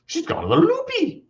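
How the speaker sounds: a hint of a clueless guy; lightly voiced dog owner.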